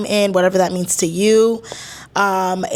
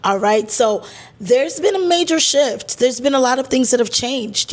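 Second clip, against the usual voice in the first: ordinary delivery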